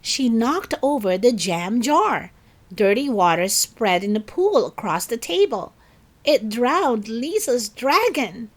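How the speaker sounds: ordinary delivery, nothing marked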